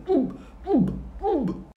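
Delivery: high, short, muffled grunts; mute man's grunts. Acted. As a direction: imitate wordless high grunts. Mute man's wordless reply imitated.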